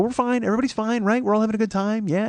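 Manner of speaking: high-pitched, hurried reassurance; smooth over the quarrel, insisting all is well; placating. Retold or imitated. Laughing off quarrel, smoothing things over.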